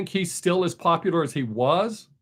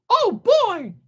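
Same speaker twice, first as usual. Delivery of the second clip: very high, squeaky cartoon mouse voice; Mickey Mouse; 3 dB louder than usual. Streamer mocking what people spit out, Mickey Mouse voice. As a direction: exclaim it in a high cartoon falsetto. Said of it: "like my Mickey Mouse voice".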